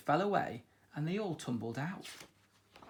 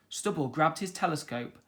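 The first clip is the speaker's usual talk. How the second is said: plain reading voice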